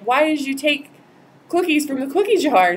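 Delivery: mock-scold a kid over cookies; scolding a child. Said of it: "When you ask a child, like".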